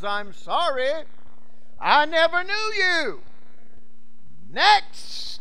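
high, loud pronouncement of judgment; preacher's ringing voice of the Judge; cold divine judge. Acted. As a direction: dismiss them loudly, stretching the words. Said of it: "he looks with that cold stare and says".

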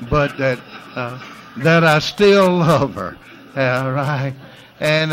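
loud, high, driving sermon; full-force preaching. Real, not acted. Pastor preaching freedom to his congregation at full force.

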